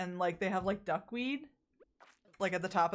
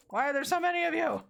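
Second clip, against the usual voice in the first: exasperated; 9 semitones higher, 3 dB louder, faster than usual. Real, not acted. Annoyed at swarming enemies.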